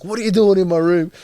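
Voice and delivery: loud, high retold yell; brother going off. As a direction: imitate an outraged brother shouting. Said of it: "my brother's going off".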